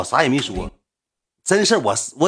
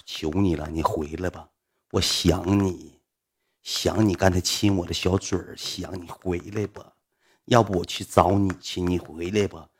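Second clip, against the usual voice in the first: soft pleading; 5 semitones lower, 4 dB quieter than usual. Retold or imitated. Drunkenly begging him to return.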